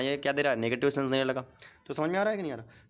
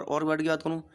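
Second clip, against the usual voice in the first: normal talking voice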